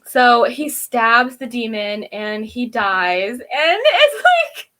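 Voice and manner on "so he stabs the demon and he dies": normal talking voice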